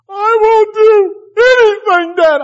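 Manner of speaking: high, very loud, drawn-out wail; hammed-up tearful whine; mock begging child. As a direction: imitate a desperate kid pleading with dad. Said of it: "Begging, begging, begging".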